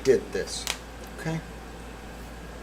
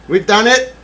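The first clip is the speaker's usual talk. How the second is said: triumphant; 8 semitones higher, 9 dB louder than usual. Real, not acted. Loud take.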